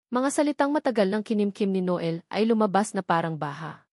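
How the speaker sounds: normal talking voice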